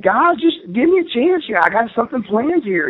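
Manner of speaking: pleading with the guys. Retold or imitated. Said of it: "I said".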